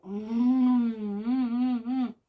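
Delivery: loud, drawn-out mms of pure food bliss; savouring mm. Real, not acted. A woman tasting fruit, moaning mm with delight. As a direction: really relish every bite out loud. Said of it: "I shouldn't really eat and talk".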